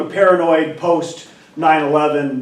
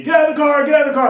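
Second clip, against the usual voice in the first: high, loud barked order; screaming cop; 13 semitones higher, 6 dB louder, much faster than usual. Retold or imitated. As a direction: yell it like a cop with guns drawn. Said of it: "screaming at me".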